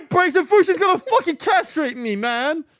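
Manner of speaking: high, loud exasperated yell; comic panicked outburst; frantic protest. Real, not acted. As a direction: protest at the top of the voice, easing at the end. Protesting friend's suggestion to rip cable.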